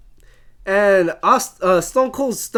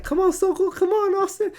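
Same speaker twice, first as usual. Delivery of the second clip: high-pitched, softer mock pleading; high, light wheedling impersonation; imitated coaxing; 10 semitones higher, 5 dB quieter than usual. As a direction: imitate someone egging on a friend in a high voice. Retelling wrestler urging Austin to dance.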